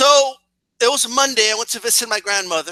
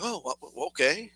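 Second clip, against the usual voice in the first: resigned mumble; 5 semitones lower, 11 dB quieter than usual. Retold or imitated.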